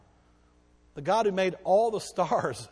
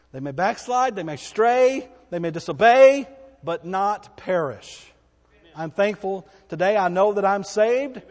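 louder, ringing pulpit proclamation; preaching at full force; 4 semitones higher, 10 dB louder than usual. Acted. Pastor assuring the congregation of salvation, preaching hard. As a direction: build each line like a revival preacher. Preaching climax, rising volume.

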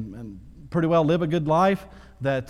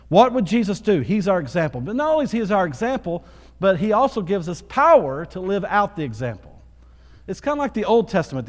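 louder and higher sermon drive; preaching at full force; 4 semitones higher, 4 dB louder than usual. Acted. Pastor urging the church to follow Christ, fervent preaching. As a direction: preach it, lift the room.

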